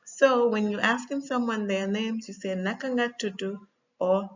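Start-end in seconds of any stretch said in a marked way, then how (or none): 2.5-3.6 Senegalese Wolof, "tudu" closing on "oo"; Senegalese accent. Shown as a demonstration. Teacher offering learners the first option, Senegalese accent. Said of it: "one is Senegalese accent".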